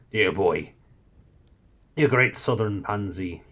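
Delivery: scornful Scottish Shadwell. A narrator's reading. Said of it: "no longer metaphorically crouched in deference".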